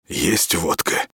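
сиплый, чужой голос; прохрипеть не своим голосом; хрипло. Acted. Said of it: "прохрипел Марк не своим голосом". (хриплый голос)

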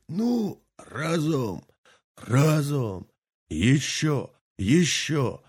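high, effortful heaves; old man hauling the heavy pot; straining effort. Acted. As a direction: push out each word as you heave.

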